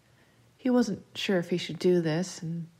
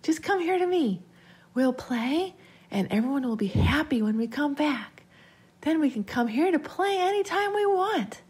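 high, singsong coaxing; eerie child's eager plea; persuading child voice; 8 semitones higher than usual. A narrator's reading. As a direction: make one last push to lure him. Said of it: "making one final push to convince him".

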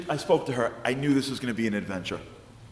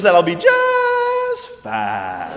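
very slow, louder Southern approval; slow Southern drawl; 6 semitones higher, 7 dB louder, much slower than usual. Retold or imitated. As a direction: stretch it out like a satisfied Southern lady. Betsy satisfied, slow.

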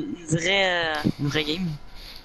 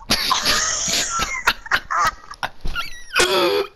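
very high hysterical laughter; shrieking laugh. Real, not acted. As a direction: cackle out of control.

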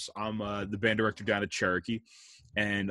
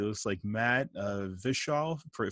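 ordinary delivery

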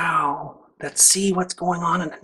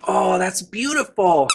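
usual speaking voice

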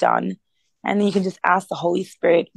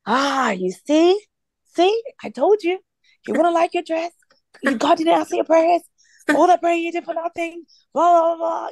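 taunting enemy; 9 semitones higher than usual. Retold or imitated. The enemy bombarding her with doubts, high mocking taunt. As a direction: imitate a gloating tormentor in a high voice. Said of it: "the enemy started to bombard me with thoughts".

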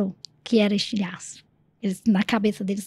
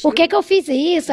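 higher, emphatic rueful question; acted self-reproach; 6 semitones higher, 4 dB louder than usual. Voicing someone who finally faces reality, acted self-reproach. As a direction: reproach yourself with a raised voice.

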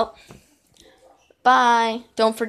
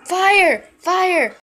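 shouting fire; 8 semitones higher, 4 dB louder than usual. Acted. Yelling to wake someone.

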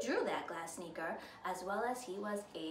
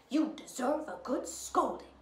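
scolding godmother; 2 semitones higher, 4 dB louder than usual. A narrator's reading. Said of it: "she started to scold them".

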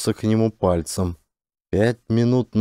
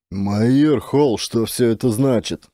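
ordinary delivery, nothing marked

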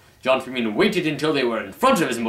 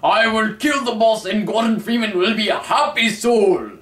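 loud, higher hammed-up yell; 10 semitones higher, 7 dB louder than usual. John Freeman yelling his vow after reading mom's email. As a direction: bellow it with mock heroic grief. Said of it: "got sad and yelled".

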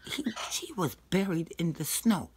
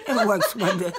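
words broken by real laughter; laughing through words. Real, not acted. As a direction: talk while still giggling. Still laughing after the bra joke.

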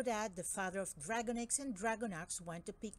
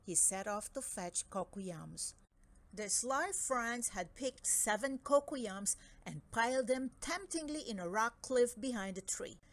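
plain reading voice

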